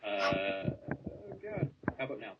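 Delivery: distant, faint mic; from across the room. Real, not acted. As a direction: far off from the microphone. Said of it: "Sounds like you're across the room".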